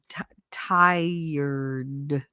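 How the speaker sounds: "tired" drawn out slowly with its r sounded; attempted correct R. Shown as a demonstration. Jersey girl trying to say it correctly, laboured slow "tired". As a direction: stretch "tired" carefully, r and all. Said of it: "I can't even say it correctly".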